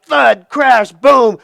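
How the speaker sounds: booming storybook sound effects, hit hard; loud crashing. A narrator's reading. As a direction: punch out each loud impact word. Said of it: "he hears a loud".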